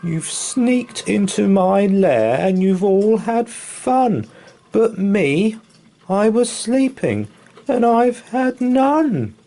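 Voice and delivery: high, loud, whiny bear complaint; whimpering bear. Acted. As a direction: wail it like a big sulky bear. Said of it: "he whimpers and he moans".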